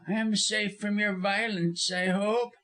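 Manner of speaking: high, wary character voice; apprehensive. A narrator's reading. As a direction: ask nervously for assurance. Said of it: "looking apprehensively at Ellis".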